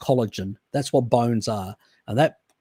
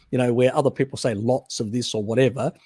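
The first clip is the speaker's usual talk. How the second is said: usual speaking voice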